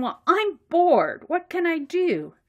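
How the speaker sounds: Macy, bored. Acted. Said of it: "And so Macy's going".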